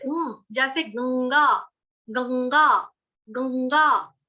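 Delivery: slow गंगा with nasal ं; nasal गंगा drawn out; slow nasal demo; nasal anusvara. Shown as a demonstration. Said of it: "नाक और मुंह हम दोनों से".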